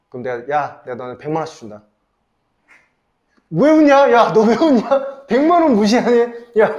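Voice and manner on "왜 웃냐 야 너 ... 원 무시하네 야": mock offended. Teasing students who laugh.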